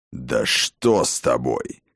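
low, grave warrior voice; stern old warlord. Acted. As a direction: speak as a grim old war chief. Voicing the war father.

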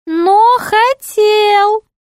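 very high, slow jester voice; high, drawn-out jester taunt; tattling jester. Acted. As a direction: draw out the threat in a piping pitch.

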